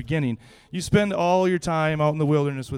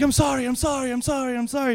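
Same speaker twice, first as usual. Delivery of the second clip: higher, rapid repeated apology; a flustered friend babbling sorry; mock apology; 7 semitones higher, faster than usual. Matt apologizing after spraying him.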